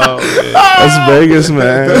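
wheezing through laughter; laughing. Real, not acted. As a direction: laugh while saying it. Laughing at the story.

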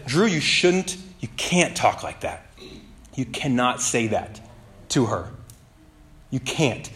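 soft, slow, firm inner voice; a quiet correction from within; gentle rebuke. Retold or imitated. The Holy Spirit correcting Drew in his anger, soft and firm.

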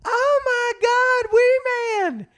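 very high, drawn-out excited scream; slow high-pitched mock scream; retold fan scream. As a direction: shriek it like a starstruck fan spotting an idol. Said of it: "this woman, like, screams".

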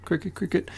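chirping cricket noise made with the mouth; cricket chirps. Real, not acted. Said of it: "My cricket noise".

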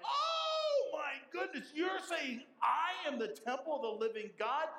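a stunned listener who can't believe it; astonished gasp. Retold or imitated.

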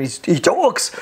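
ordinary delivery

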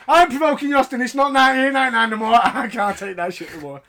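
a hint of a flustered Vince; imitating Vince. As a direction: rattle it off like a panicked old boss. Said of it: "He's like".

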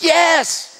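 high, loud mock yelp; imitated yell. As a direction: shriek the answer as the woman. Retold woman yelling her answer.

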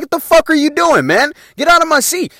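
high, loud exasperated telling-off; acted-out indignant scolding. Scolding friends fooling in car seat.

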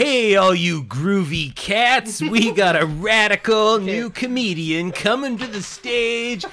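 slow, drawn-out groovy emcee; groovy eighties MC. Acted. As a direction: hype up the crowd like a seventies host. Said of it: "Think s".

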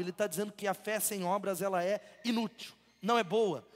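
usual speaking voice

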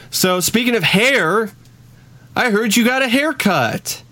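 high-pitched, hammed-up cheesy host line; cheesy segue. Host steering the awkward chat to a haircut, cheesy segue. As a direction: stretch it into a corny segue. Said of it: "that's the cheesiest".

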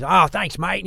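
a cheery Aussie "thanks, mate", badly done; Aussie accent. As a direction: toss it off like a chummy Australian. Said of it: "that was an Aussie accent".

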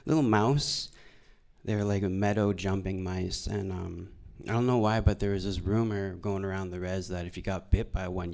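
normal talking voice

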